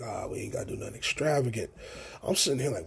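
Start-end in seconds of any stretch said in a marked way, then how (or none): 0.0-1.7 whispered mocking echo of mom. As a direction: parrot her words back in a hushed mock whisper. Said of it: "So she's like".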